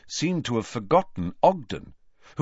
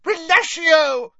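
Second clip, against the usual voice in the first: high, loud, drawn-out incantation; cried spell; 7 dB louder, slower than usual. A narrator's reading. Ogden casts to rescue the girl, crying out. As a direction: shout the spell at full force. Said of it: "Ogden raised his wand and cried".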